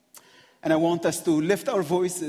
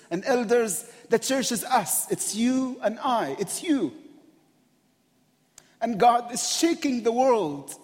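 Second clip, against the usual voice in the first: full-force preaching; 5 semitones higher than usual. Acted. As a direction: build the sermon to its peak. Preaching at rising intensity.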